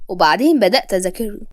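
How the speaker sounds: ordinary delivery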